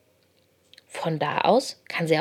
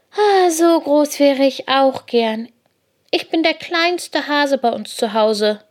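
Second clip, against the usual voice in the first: put-on high, drawn-out wistful child-like voice; envious little hare; 7 semitones higher, 4 dB louder than usual. A narrator's reading. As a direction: voice a small hare longing to be big. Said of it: "guckt ganz neidisch an Paul hoch".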